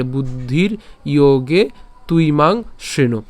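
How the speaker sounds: slow, pause after "ইয়োগে", then "তুই মাং" together; phrased by word. Shown as a demonstration. Teacher modelling the final phrasing, "ইয়োগে" and "তুই মাং" slowly separated. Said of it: "আমরা ইয়োগে পর্যন্ত উচ্চারণ করব".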